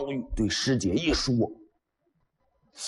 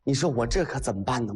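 plain reading voice

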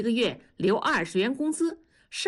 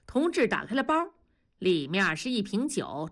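plain reading voice

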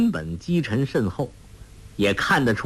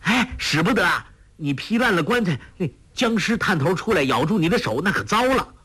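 high, hurried cry of warning; agitated, pitched-up pleading; frantic; 7 semitones higher, faster than usual. A narrator's reading. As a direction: protest fast in alarm to stop someone. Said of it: "杨康大急， 叫道".

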